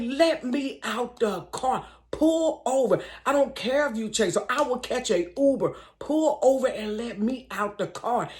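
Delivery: high, insistent order to pull over; demanding to get out. Retold or imitated. To the drunk driver, a high insistent demand. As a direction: insist on getting out. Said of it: "So I'm like".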